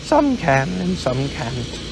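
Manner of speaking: unhurried bear musing. Acted. Pooh thinks aloud.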